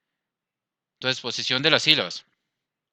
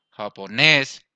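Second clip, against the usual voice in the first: "japonés" with a loud final "nés"; "japonés", last syllable louder and longer; stress on last syllable; 5 dB louder, much slower than usual. Shown as a demonstration. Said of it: "tiene el acento en la última sílaba".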